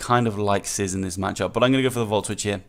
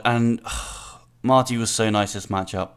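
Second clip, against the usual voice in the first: usual speaking voice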